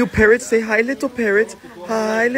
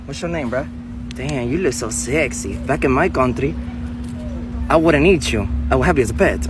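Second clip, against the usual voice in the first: a much lower, smooth charmer talking to animals; low flirty foreign voice; 10 semitones lower than usual. Acted. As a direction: purr at the animal like a ladies' man.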